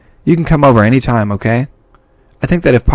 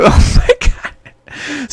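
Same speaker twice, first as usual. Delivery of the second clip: slow airy high laugh; unvoiced giggling oh my God; breathy laugh; 14 semitones higher, much slower than usual. Real, not acted. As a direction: wheeze it out, laughing in disbelief. Laughing at dark dialogue.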